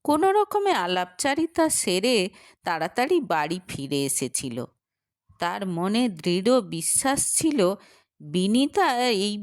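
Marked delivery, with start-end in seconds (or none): none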